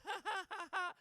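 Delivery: high mock cackle of an audience member; imitated cackle. Mocking a woman in the crowd's laugh, high mock cackle. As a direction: ham up a stiff little laugh. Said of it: "She laughed like she was Kamala".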